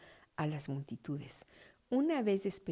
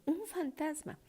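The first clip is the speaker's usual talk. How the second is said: mild frightened cry; 9 semitones higher than usual. A narrator's reading. Disciples seeing a figure walk on the sea, understated frightened cry. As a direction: read a startled cry with restraint. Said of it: "gritaron de miedo".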